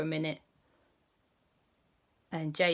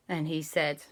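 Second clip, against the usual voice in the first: usual speaking voice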